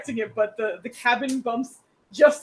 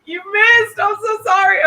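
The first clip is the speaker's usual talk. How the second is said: high, loud cry of sympathy; loud dismay; 10 semitones higher, 10 dB louder than usual. Real, not acted. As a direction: wail the bad news apologetically. Game master reacts to disastrous roll.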